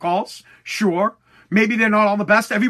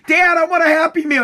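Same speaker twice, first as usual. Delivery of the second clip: put-on higher, louder little kid asking; whiny kid; 6 semitones higher, 4 dB louder than usual. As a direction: beg like a small child. Kid begging his dad.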